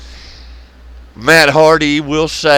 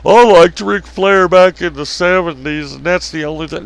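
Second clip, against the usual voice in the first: mock whiny fan; 7 semitones higher, 5 dB louder than usual. Mocking a fan complaining at the store. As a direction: mimic a complaining old fan. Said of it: "you come in hollering and screaming".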